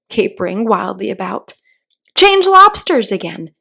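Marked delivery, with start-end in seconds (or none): 2.1-3.5 high, loud full yell; yell at the top of his voice. Acted. Gryphon yells the dance call at full voice. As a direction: yell it as loud as you can. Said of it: "yelled the Gryphon at the top of his voice".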